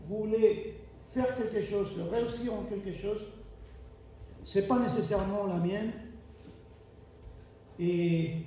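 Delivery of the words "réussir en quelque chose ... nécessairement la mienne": normal talking voice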